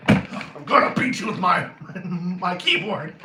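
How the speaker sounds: giggling through a pretend threat; laughing mock threat. Joking threat during laughter as a remedy, giggling. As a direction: break up laughing while threatening.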